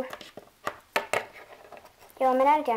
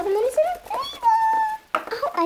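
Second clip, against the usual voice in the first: pained whine; 3 dB louder, slower than usual. Pretend. Wound stings, complaining to mom.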